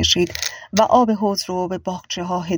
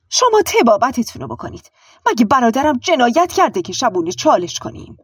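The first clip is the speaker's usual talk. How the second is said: higher, indignant aunt; indignant retort; 5 semitones higher, 3 dB louder than usual. A narrator's reading. Aunt to the doctor about her brother, indignant. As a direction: throw it back at the doctor, offended.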